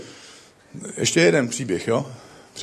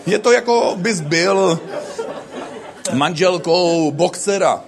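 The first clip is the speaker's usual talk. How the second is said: laughing; 5 semitones higher, slower than usual. Real, not acted. Joke told laughing.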